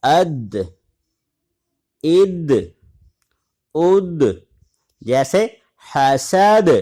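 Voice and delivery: حسد ending with a qalqalah echo; qalqalah on دال. Shown as a demonstration. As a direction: bounce the final د back out. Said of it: "جزم کے ساتھ قلقلہ ہوگا".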